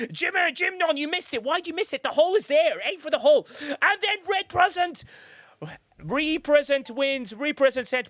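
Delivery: urging the marble on, voice up high; excited commentary. Real, not acted. Excited race commentary yelling.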